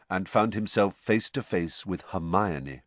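ordinary talk

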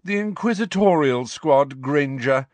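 lazy arrogant nobleman. A narrator's reading. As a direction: savour it, looking down on her. Malfoy gloating, superior.

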